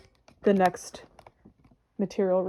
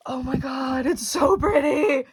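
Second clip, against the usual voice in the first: louder, slow, drawn-out gush; delighted squeal; 3 semitones higher, 6 dB louder, slower than usual. Real, not acted. Admiring the new material, a slow delighted gush.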